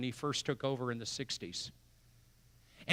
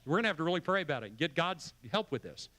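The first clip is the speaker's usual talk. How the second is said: ordinary delivery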